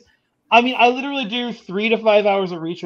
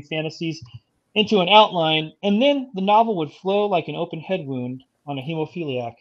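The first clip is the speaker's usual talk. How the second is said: steady read-aloud story voice; story narration; 3 semitones lower than usual. Acted. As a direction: read the pitch like a thriller blurb. Reads his written pitch aloud.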